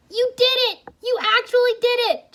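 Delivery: very high, loud joyful shout; overjoyed cry. A narrator's reading. Jay cheers Wu after his return, high loud joyful cry. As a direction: burst out in delight. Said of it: "Jay cried".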